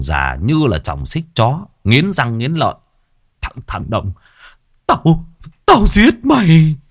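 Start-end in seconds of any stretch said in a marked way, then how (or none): 3.4-6.8 put-on high, louder enraged old man; furious threat. Bald man threatens Đồng, furious rising shout. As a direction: spit the threat through clenched teeth, rising to a roar.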